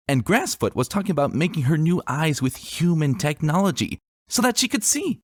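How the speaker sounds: put-on high, chattering child voice, rising with excitement; a small girl gushing about her favourite story; eager little girl. A narrator's reading. Daughter telling her father about a novel, high eager voice.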